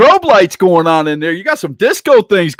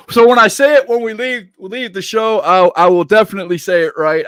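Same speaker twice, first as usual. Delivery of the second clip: ordinary talk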